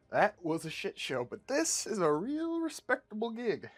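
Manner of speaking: slow, swooping high on "this"; emphatic insistence. Acted. Rainbow Man selling the crew a job, emphatic. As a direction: stretch "this" and lift "real respectable gig".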